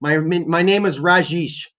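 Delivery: high, hammed-up foreign caller greeting; mock Rajesh caller voice. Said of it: "and be like".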